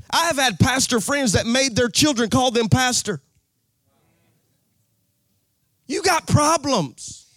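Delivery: slow, high preached punchline; fervent preaching. Acted. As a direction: lean into the preaching, drawn out. Preaching at full force.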